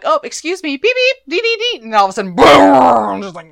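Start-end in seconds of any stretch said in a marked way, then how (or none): 0.0-1.8 much higher, louder sing-song mockery; mock prissy voices. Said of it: "Nat and Elena are all like". 2.4-3.2 loud mock boom; explosion noise. Mimicking the crash.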